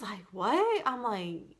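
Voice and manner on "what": much higher startled "What?"; husband hearing the news, a high startled yelp; shocked what. Retold or imitated. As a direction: jolt up in surprise. Husband hears test is positive.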